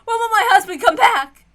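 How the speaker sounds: very high, loud, sobbing warnings; hysterical crying. Acted. Ava warning of the Golden Witch, hysterical. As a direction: shriek your warnings in terror. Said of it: "emotions explode even more".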